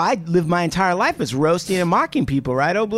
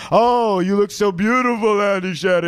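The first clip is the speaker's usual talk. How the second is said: Sicilian charmer; 3 semitones higher than usual. Retold or imitated. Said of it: "He does this whole Sicilian thing".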